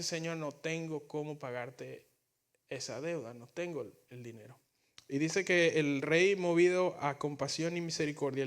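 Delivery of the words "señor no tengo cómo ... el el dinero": soft, hushed plea trailing away to almost nothing; pleading servant. Retold or imitated. A debtor begging his master for time, softly pleading. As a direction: beg quietly like a man with nothing left.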